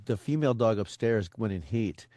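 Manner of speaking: normal talking voice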